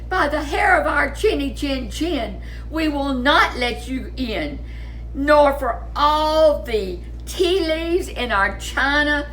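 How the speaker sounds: little wolves refusing. Acted.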